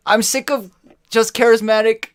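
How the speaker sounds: high, loud fed-up rant. Real, not acted. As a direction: vent it loudly. Ranting about extroverts.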